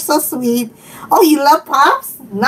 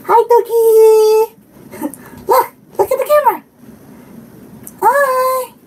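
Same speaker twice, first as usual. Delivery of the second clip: dog talk; 7 semitones higher than usual. Real, not acted. Talking to her dog.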